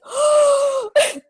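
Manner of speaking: high loud gasp of shock; teacher's mock gasp. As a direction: gasp in horror. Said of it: "his face was just like".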